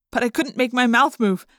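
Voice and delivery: ordinary delivery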